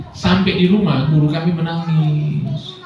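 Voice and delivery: ordinary delivery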